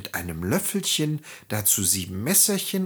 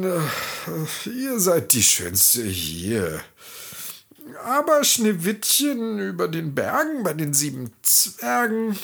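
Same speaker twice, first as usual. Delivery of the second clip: magic mirror nervously telling the queen the truth; hesitant mirror; 7 semitones higher than usual. A narrator's reading. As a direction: stall and hesitate before the bad news. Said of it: "Der Spiegel antwortete zögerlich".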